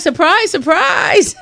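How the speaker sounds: high-pitched excited squeal; squealed surprise. Retold or imitated. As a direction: shriek it with delight, way up high. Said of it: "I'm like".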